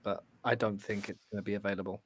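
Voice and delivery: ordinary talk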